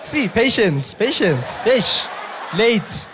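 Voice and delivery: loud, high exclamations; mock exasperation. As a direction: tut loudly at latecomers. Mock scolding of latecomers.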